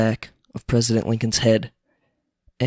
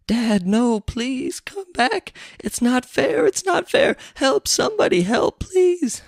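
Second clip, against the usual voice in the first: very high, hammed-up pleading cry; mock wailing son; 14 semitones higher, slower than usual. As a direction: wail over your father, overdone. Son wails over dead father.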